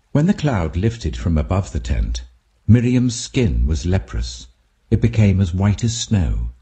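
usual speaking voice

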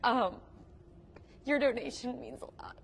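fighting back tears, voice wavering; holding back tears while thanking; teary-eyed. Real, not acted. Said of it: "I'm gonna get teary-eyed".

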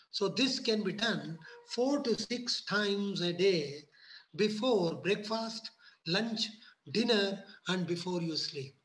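slowed down for emphasis; slow, deliberate. Shown as a demonstration. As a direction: spell out each mealtime slowly. Repeated advice, slow emphasis.

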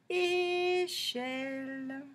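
Échelle very slow, both syllables held; a long É, then a long CHELLE; drawn-out syllables. Shown as a demonstration. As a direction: stretch Échelle out on level, sustained tones. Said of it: "on va chercher tous les mots".

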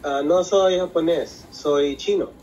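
usual speaking voice

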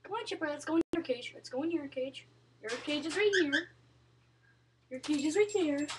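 soft pet-coaxing murmur; quiet coaxing. Real, not acted. Coaxing bird toward its cage.

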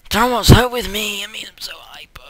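high, giddy outburst thinning to a squeak; hyper, voice tailing into an airy squeal; hyperactive. Real, not acted. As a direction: bubble over, pitch way up. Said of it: "I'm so hyperactive".

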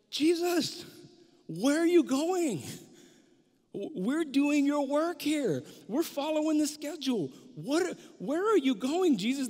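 loud, high, slow plea; calling out. Retold or imitated. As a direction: shout after him as he walks by. Disciples calling to passing Jesus.

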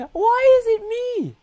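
high, loud whining cry; whiny wail. Real, not acted. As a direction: wail at the bad luck. Unlucky roll, whining.

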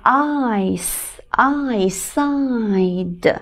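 very slow, stretched "eyeside"; drawn-out sounding out. Shown as a demonstration. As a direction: sound out "eyeside" piece by piece, slowly. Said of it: "我们一起拼读一下这个单词".

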